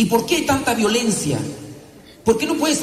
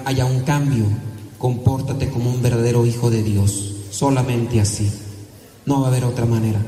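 solemn exhortation; 7 semitones lower than usual. Acted. Priest voicing God's call to the congregation, low and grave. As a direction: speak as God calling them home, deep and measured.

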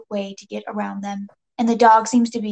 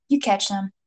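usual speaking voice

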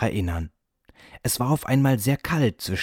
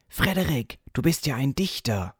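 admiring exclamation; 6 semitones higher, 3 dB quieter than usual. A narrator's reading. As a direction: exclaim high in delighted discovery. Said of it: "klatschten alle und riefen".

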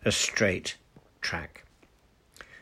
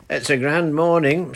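put-on old charcoal burner; 7 semitones higher, 7 dB louder than usual. A narrator's reading. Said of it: "said the old charcoal burner".

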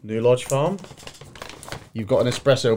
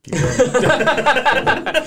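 much higher, stretched, rising on going; high drawn-out call; 14 semitones higher, 4 dB louder than usual. Acted. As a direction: sing it out high and long. Laughing urge to continue.

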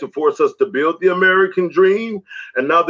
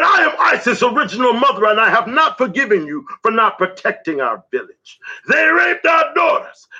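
accusing ancestral mother; 7 semitones higher than usual. Acted.

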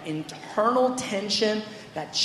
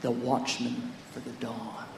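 hushed echo; 9 semitones lower, 11 dB quieter, slower than usual. Retold or imitated. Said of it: "and then he goes".

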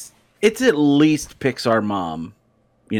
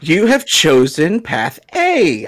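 slow, louder mock announcement; game announcer; 5 semitones higher, 4 dB louder, slower than usual. Friend mocks an adventure book's prompt, game announcer. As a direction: draw out the choice like a game prompt.

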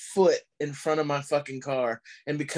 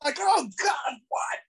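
mock panic; 14 semitones higher than usual. Imitating the panicked version of the friend's warning. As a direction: shriek it like you're caught off guard. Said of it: "If he'd been like".

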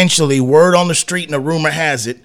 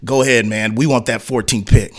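mock team exec; 7 semitones lower than usual.

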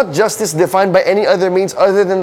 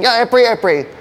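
higher-pitched, hasty self-mimicry; lightly mock your own excuse, pitched up; defensive brush-off; 6 semitones higher than usual. Preacher admits his excuse about praying, mock-defensive.

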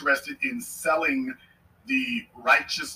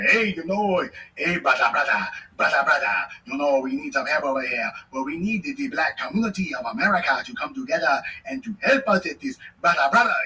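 mock pleading caller; 3 semitones higher than usual. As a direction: ham up someone begging for help they never gave.

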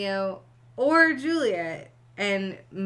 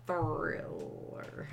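whisper; 2 semitones lower, 8 dB quieter than usual. Real, not acted. Repeating title, unvoiced.

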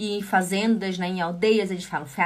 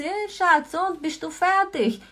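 a lightly voiced husband, higher, calling to her; husband asking; 7 semitones higher than usual. Husband asks wife in kitchen if dinner's done, lightly voiced. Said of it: "meu marido fala assim".

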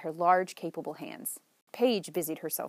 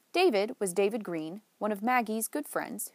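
usual speaking voice

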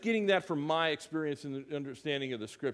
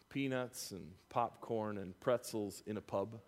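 ordinary delivery

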